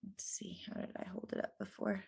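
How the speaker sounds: whispered muttering to oneself; under the breath. Real, not acted. Reader checking how she held the rune, whispering to herself. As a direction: drop to a quick, absent whisper.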